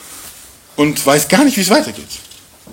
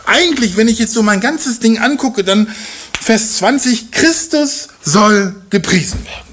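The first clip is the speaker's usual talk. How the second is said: lightly higher, earnest Paul voice; voicing Paulus; 6 semitones higher than usual. Preacher voicing Paul to the congregation, earnest and higher. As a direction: speak as Paul musing from prison.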